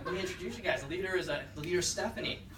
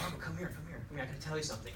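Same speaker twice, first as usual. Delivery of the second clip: much softer, secretive beckoning; hushed urgent call; 11 dB quieter, much faster than usual. Retold or imitated. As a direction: friend urgently calling him aside, hushed. Colleague pulls him aside secretly.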